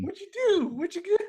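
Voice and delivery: very high mock-panicked squeal; panicked demand. Washu asks Mihoshi what she did, panicked demand.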